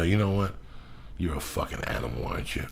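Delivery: quietly calling someone out, retold. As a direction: drop the voice right down to confront her. Retold straight-in-the-eye remark to passenger.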